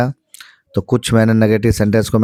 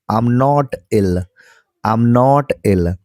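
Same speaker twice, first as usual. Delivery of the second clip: I'm not ill, stretched out very slowly; slow it right down so students can copy; very slow drill; much slower than usual. Shown as a demonstration. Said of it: "five times बोलना है". (बहुत धीमी रफ़्तार में अभ्यास)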